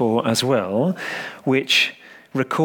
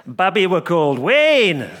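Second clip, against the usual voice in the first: put-on much higher, louder insistent child; boy crying out undeterred; 6 semitones higher, 4 dB louder than usual. A narrator's reading. Little boy insisting to the vicar, undeterred. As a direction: insist like a stubborn little lad.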